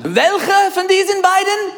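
high, loud questioning governor; Pilate asking. Pretend. Preacher as Pilate, calling out to the crowd. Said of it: "I'm going to be Pilate".

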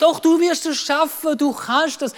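imitated parent urging him on; encouraging. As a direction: counter the child's doubt eagerly. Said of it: "Wir haben ihn motiviert".

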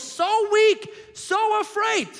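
so weak, so afraid, pitched high and slow; a high, strained cry of weakness; anguished emphasis. Acted. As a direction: lift your voice high and stretch weak. Preacher stresses Jesus' fear.

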